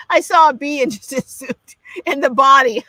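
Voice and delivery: real laughter breaking into the line; laughing. Real, not acted. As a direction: laugh at your own silly misreading. Laughing at her own misreading.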